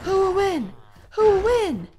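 high, very loud hype yell; real full-volume cry of suspense; excited shouting. Real, not acted. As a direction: shout it high like a fight spectator. Cheering on the fight.

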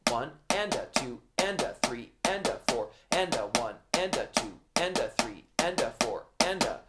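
soft steady counting in time with the drums; quiet metronome-like count; counting aloud in rhythm. Shown as a demonstration. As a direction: chant the counts quietly and evenly. Counts along while playing drums.

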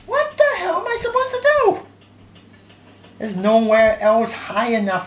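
high exasperated outburst; raging gamer. Real, not acted. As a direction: rant in disbelief at the game. Raging at impossible level.

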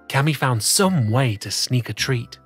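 plain reading voice

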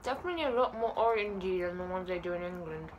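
talking around chocolate; chewing while talking. Real, not acted. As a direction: mouth partly full. Seen 1: Chocolate put in mouth at -5; jaw and cheeks keep chewing throughout.

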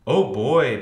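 loud, eager outburst; exclaiming. A narrator's reading. Buster thrilled about the meeting, exclaiming. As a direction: blurt it out with excitement. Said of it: "Buster exclaimed".

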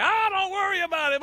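high, loud careless brush-off; buddies brushing it off. Retold or imitated. Buddies telling the driver not to worry, loud and carefree. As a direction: wave it away, loud and breezy. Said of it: "They're like".

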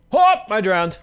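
loud high startled shout; startled yelp. Real, not acted. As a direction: yelp as you hit water. Jumping into water.

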